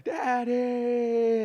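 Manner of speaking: put-on high, delighted child's cry of Daddy; excited child calling out. Child running to greet a soldier home from the army, high and excited. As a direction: call out like a small kid seeing dad come home.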